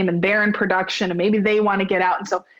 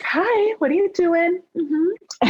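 much higher, sing-song innocence; guilty-innocent phone greeting; fake innocent hi; 9 semitones higher, slower than usual. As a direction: play it overly sweet. Answering caught, fake innocent.

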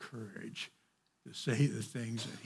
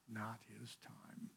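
soft echo; 15 dB quieter, slower than usual. Real, not acted. Echoes the whispered line.

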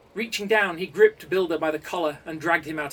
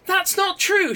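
desperate shout, much higher and louder; 9 dB louder, slower than usual. A narrator's reading. Accused coder shouting denial at the council. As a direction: yell the denial in panic. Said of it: "shouted Builder".

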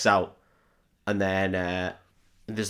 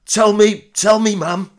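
higher, louder put-on bloke voice; mock northern bloke; 8 semitones higher, 5 dB louder than usual. Parody Trevelyan leaving his fryer to the queen, mock bloke voice. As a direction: ham up a chip-shop dad. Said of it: "he's just like".

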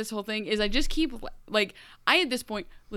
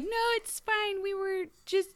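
put-on nervous girl; 11 semitones higher than usual. Red covering for Peter.

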